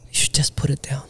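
hushed, whispered vet's advice; imitated confidential whisper; retold whisper. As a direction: whisper it as the vet breaking bad news. Retelling the vet's grim advice.

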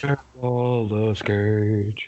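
slow, low drawn-out name; Nicolas Cage impression. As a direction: savor the name slowly. Echoing the name in a voice.

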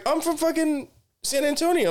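very high, hammed-up imitation; mock female contestant. Woman on the show saying where she's from, high mocking imitation. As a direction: put on a high voice to mimic her.

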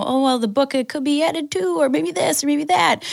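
high, airy, dismissive put-on voice; lightly mock a wishy-washy attitude. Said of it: "if we say that".

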